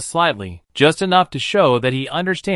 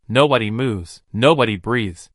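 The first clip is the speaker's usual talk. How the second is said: plain reading voice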